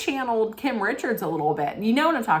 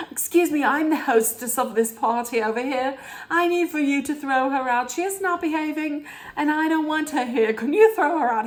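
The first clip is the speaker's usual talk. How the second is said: high, hammed-up British hostess; imitated haughty British, pitched high; mock British accent; 8 semitones higher than usual. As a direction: complain to staff in a put-on British voice. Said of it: "That's when Meredith turned British".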